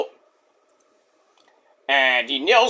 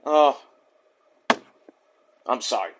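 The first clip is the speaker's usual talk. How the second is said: ordinary talk, nothing marked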